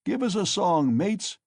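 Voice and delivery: put-on loud, high drunken call; shouted. A narrator's reading. A reveller in the cart calling for a song, shouting. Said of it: "shouted someone in the cart".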